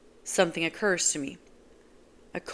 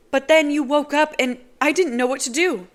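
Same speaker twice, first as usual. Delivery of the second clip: higher, louder distressed woman; upset Nazira; 9 semitones higher, 9 dB louder than usual. Acted. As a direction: blurt it out anxiously. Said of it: "she says".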